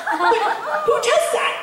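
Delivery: high, laughing, drawn-out question; laughing disbelief. Real, not acted.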